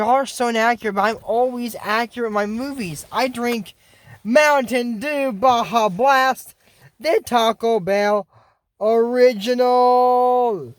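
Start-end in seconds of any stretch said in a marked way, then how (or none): 4.2-10.7 hyped-up product plug. Real, not acted. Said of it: "That is such a good drink".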